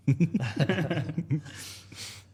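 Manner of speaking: high, loud, real laughing burst. Real, not acted. Laughs at own streaming joke.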